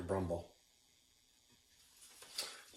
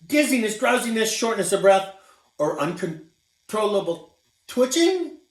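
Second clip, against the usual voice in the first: high, loud fussy doctor listing symptoms; hammed-up doctor checklist; 10 semitones higher, 8 dB louder than usual. A narrator's reading.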